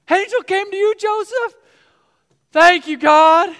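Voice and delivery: high, loud, amazed, then slow thanks; Mary hearing the angel came to Joseph, overjoyed; tearful joy. Pretend. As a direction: overflow with grateful tears.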